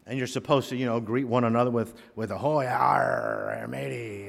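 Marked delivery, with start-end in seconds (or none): 2.3-3.7 hammed-up pirate with drawn-out arr; slow, exaggerated pirate accent; pirate greeting. Shown as a demonstration. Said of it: "it's International Talk Like a Pirate Day". 3.7-4.3 pirate voice tailing off softer; light pirate accent; pirate greeting. Shown as a demonstration. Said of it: "it's International Talk Like a Pirate Day".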